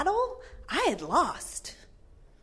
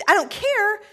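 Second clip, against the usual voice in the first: higher, louder mom snapping back; mock-stern mom, louder; louder mom authority; 8 semitones higher, 6 dB louder than usual. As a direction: repeat it harder, losing patience. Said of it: "in my particular mom authoritative voice".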